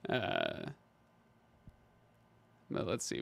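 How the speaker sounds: normal talking voice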